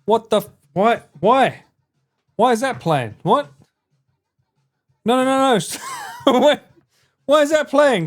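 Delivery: loud, flustered yelling; panicked shouting. Real, not acted. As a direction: shout in real alarm. Panic at sound playing.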